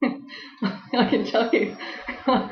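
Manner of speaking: said through a laugh, slowed; real laughter on the words. Real, not acted. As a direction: laugh while you say it. Laughing at dysfunctional family joke.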